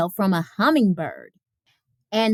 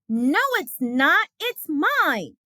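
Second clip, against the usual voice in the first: startled Ruthie; 5 semitones higher than usual. Acted. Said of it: "Ruthie was startled".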